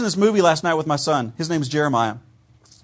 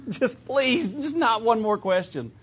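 slow, higher mock pleading with a laugh; pleading parent; 5 semitones higher, slower than usual. Pastor playing a tired parent begging for no more questions, amused.

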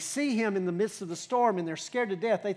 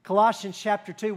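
ordinary talk

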